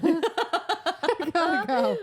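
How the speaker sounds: teasing echo while laughing; mock Cassian through laughter. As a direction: drag it out mocking him. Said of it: "Cassian's like".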